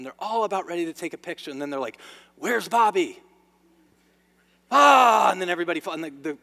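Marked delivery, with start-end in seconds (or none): 2.3-3.2 loud, higher-pitched mock holler; retold yelling. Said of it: "they start yelling at everybody". 4.7-5.3 loud, high "ah" outburst; mock yell. Acted outburst at ruined picture.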